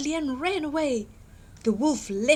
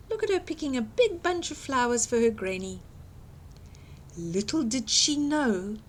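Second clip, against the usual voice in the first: plain reading voice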